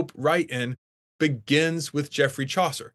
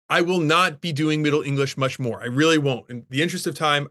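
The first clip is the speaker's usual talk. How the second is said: ordinary talk, nothing marked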